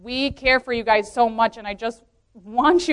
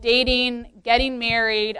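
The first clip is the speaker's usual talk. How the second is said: usual speaking voice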